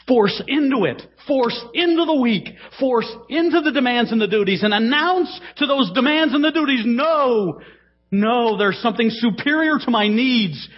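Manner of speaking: rising preacher's exhortation, pitched high; preaching. Acted. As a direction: urge the congregation forward. Preaching at full force.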